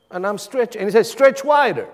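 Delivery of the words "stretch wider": high, louder command, falling at the end; raised-voice imitation of a divine order; God urging, voiced. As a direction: call it out high and insistent. Retells God urging him further.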